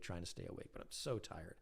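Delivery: tired murmur. Retold or imitated. Exhausted at four am.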